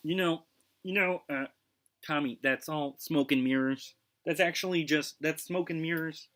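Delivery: a mild impression of a skeptical friend; hint of a knowing insider dismissing rumors; voicing Tony. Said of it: "Tony would say".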